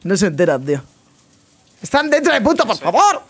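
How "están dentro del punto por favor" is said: high, raised call to the team; exasperated callout that the enemies are in the site; urgent callout. Real, not acted. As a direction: shout a warning to teammates.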